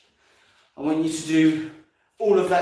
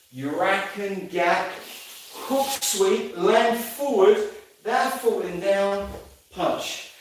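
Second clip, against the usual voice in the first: slow demo count; slower than usual. Shown as a demonstration. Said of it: "So slowly".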